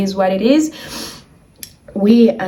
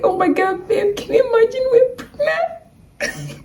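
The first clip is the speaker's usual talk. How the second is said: much higher, choked-up happy exclamation; tearful joy. Retold or imitated. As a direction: gasp it out half crying with joy. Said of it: "they were like".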